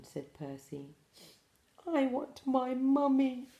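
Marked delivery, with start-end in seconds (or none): 1.9-3.5 put-on high whimpering baby owl, louder; little owl pleading for mum. A narrator's reading. Bill wailing for his mother in the dark, high. Bill's refrain.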